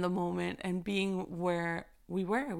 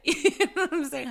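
higher, louder laughing question; 9 semitones higher, 6 dB louder than usual. Real, not acted. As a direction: laugh it out warmly. Laughs after prayer.

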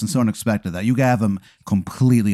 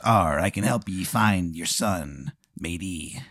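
slow, soft pirate, sinking lower; pirate tomato; 3 semitones lower, 8 dB quieter, slower than usual. Acted. Pirate tomato offers help finding the son, slow and soft. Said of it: "he's a pirate".

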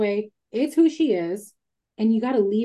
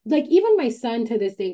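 ordinary talk

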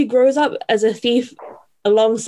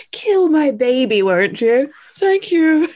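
mock mother; 6 semitones higher than usual. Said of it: "was like".

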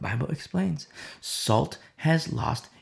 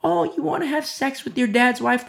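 much higher, mock-shocked question; mock incredulity; 9 semitones higher than usual. Preacher mocking a Corinth pastor, much higher. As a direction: lampoon a pastor shrugging it off. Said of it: "what the pastors were like".

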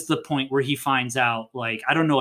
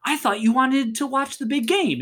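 incredulous; 11 semitones higher than usual. Retold or imitated. Retold confused protest to girlfriend.

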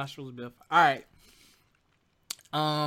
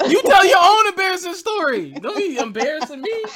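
loud protest; 13 semitones higher, 11 dB louder than usual. Real, not acted. Friend threatens embarrassing story.

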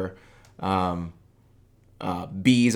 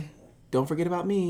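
high mocking whine; mocking whiny voice; 9 semitones higher, faster than usual. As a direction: mimic a self-absorbed poster, high. Mocking a self-centered poster.

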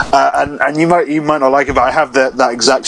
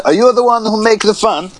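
goofy quote voice; 8 semitones higher than usual. Retold or imitated. Quoting a silly film line.

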